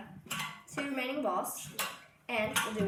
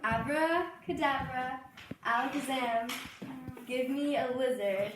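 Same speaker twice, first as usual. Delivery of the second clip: magic incantation; 2 semitones higher than usual. Pretend. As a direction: draw out the spell like a stage magician. Magic incantation over box.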